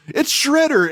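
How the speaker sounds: high-pitched imitated cry of alarm; mock alarm. Acting out Leonardo's alarmed cry.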